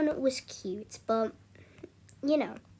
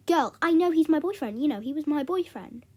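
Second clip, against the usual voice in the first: loud, higher-pitched retold indignation; mad; 4 semitones higher, 5 dB louder than usual. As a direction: act out arguing back at a friend. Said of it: "I started to get mad at her".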